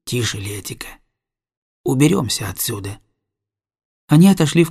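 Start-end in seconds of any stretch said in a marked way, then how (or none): 0.1-3.0 low, soft warning to be quiet; hushing. A narrator's reading. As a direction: hush your companion, voice dropped right down. Captain hushing sailor beside sleeper.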